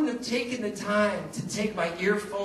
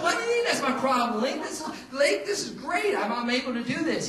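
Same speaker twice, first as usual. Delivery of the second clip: laughing protest; 7 semitones higher than usual. Retold or imitated.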